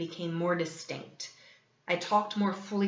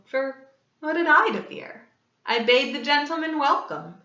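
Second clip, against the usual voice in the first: higher, louder mocking confidence; gloating bravado; 6 semitones higher, 5 dB louder than usual. A narrator's reading. Killer cheerfully welcoming the police officers. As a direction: taunt with false ease.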